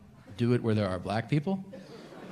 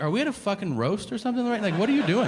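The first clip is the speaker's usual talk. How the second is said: indignant retort; 10 semitones higher than usual. Retold or imitated. Indignant at the doctor.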